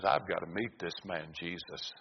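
very soft and low, almost to oneself; hushed resolve. Acted. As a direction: drop right down, a woman quietly resolving. Woman's private resolve, voiced low.

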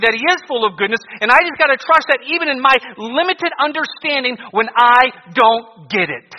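loud, high fervent preaching; preaching at full force. Acted.

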